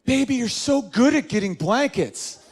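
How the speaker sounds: high, cooing mock girlfriend; imitated woman buttering up her man; flattering girlfriend. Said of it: "ladies, you really wanna get us around your finger".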